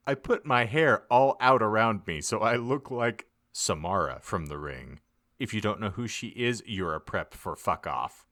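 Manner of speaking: plain reading voice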